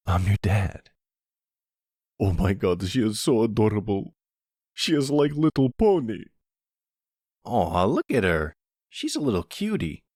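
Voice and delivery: put-on high, cooing voice of a woman melting over a baby; gushing over baby. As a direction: coo over the little one like she's the cutest thing alive.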